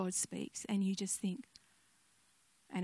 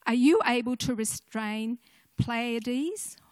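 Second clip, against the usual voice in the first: loud, solemn proclamation of God's words to Job; scripture reading; 3 semitones higher, 8 dB louder than usual. Acted. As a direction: declaim it like God thundering from the heavens.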